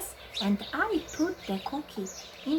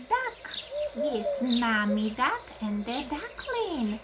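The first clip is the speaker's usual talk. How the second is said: ordinary talk, nothing marked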